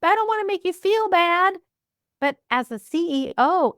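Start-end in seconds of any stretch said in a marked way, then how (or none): 0.0-1.6 much higher, gushing and apologetic voice; mock fretful female boss, pitched way up; mock emotional woman. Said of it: "as women, we're like".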